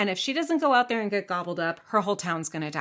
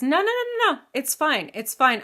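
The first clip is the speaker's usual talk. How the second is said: calling out; 8 semitones higher, 6 dB louder than usual. Acted. George calls people back.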